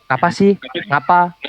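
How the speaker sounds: brisk, casual firmness to a friend calling your name; firm Banjarnegara tone. Shown as a demonstration. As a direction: answer a peer of the same age curtly and firmly. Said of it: "Intonasi atau gaya pengucapan Banjarnegara itu tegas".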